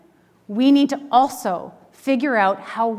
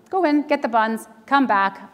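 ordinary talk

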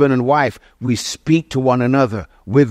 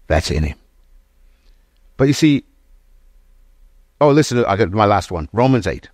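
normal talking voice

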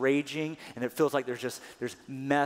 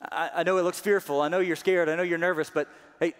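encouraging God; 5 semitones higher, 7 dB louder than usual. Acted. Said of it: "God would simply say".

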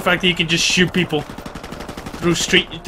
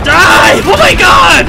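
loud, higher shout; gamer shout; 8 semitones higher, 6 dB louder, much slower than usual. Real, not acted. Gamer yelling at an enemy player, loud and high. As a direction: yell it at the enemy.

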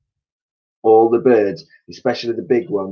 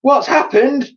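very high, loud scream; 8 dB louder than usual. A narrator's reading. Missus Twit seeing the room upside down, very high panicked scream. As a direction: shriek in panic. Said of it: "screamed Missus Twit".

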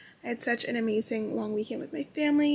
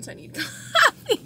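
laughing; 12 semitones higher, 8 dB louder than usual. Real, not acted. Laughs mid-sentence about coffee.

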